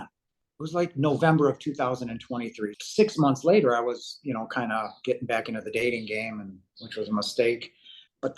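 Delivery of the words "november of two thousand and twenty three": ordinary talk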